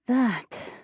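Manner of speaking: high, contented woman; satisfied woman's voice. A narrator's reading. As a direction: savour it, fully satisfied. Alessia, spent, afterwards.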